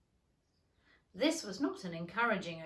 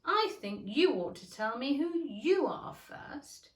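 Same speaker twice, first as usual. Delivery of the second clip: grave; 5 semitones higher, 5 dB louder than usual. Acted. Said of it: "said very gravely".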